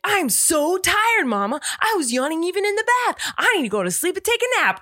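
a mother voicing her son claiming he needs a nap; imitating little son. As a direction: drag out "so tired" like a wired kid. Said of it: "He's like".

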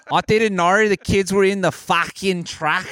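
slow Aussie excuse-making; Australian accent. Acted. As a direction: protest innocently in a put-on Australian accent. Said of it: "trying to do Australian".

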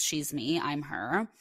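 ordinary talk

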